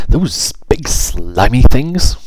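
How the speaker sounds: appalled, drawn-out query; disgusted disbelief. A narrator's reading. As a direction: draw out the revulsion on each word. Fairy repulsed by slugs.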